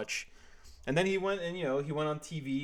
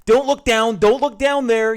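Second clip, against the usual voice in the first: high, loud and frantic; panicked George voice; 10 semitones higher, 14 dB louder than usual. Acted. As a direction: beg yourself not to look. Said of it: "he muttered to himself".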